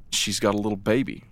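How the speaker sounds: ordinary talk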